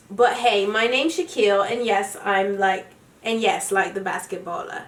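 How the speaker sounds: reading aloud in a put-on British accent. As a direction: read it like a Brit. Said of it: "read it in my British accent".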